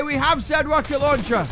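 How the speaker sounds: ordinary talk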